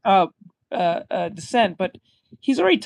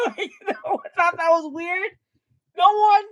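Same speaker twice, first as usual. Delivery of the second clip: laughing disbelief; 9 semitones higher than usual. Real, not acted. Laughing at the show's absurdity.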